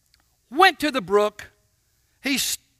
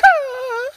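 high whining yelp like an impatient dog; mock dog whine; 13 semitones higher, 6 dB louder than usual. Preacher comparing himself to the whining dog, high mock whine. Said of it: "and I'm going".

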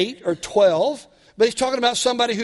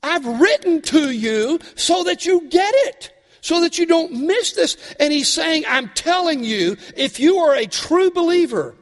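pleading with the church to hear; fiery preaching; 9 semitones higher, 4 dB louder than usual. Acted. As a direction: preach it at full force to the room. Preaching emphatically to the congregation.

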